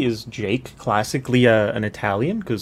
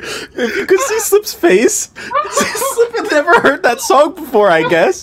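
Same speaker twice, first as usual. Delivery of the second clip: loud, high-pitched, laughing through the words; real cracking up; 5 dB louder than usual. Real, not acted. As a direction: tell it while dying of laughter. Cracking up after the song.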